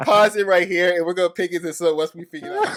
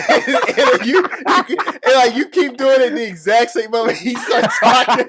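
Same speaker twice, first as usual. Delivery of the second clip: laughing; 5 semitones higher, 5 dB louder than usual. Real, not acted. Laughing while describing the mixup.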